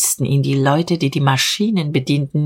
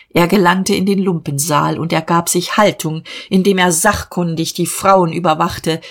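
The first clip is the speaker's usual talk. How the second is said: usual speaking voice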